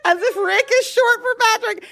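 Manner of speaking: high, squeaky laughing speech; laughing through words. Real, not acted. Mocking a friend's alias to cohosts, high laughing voice. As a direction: barely get it out for laughing.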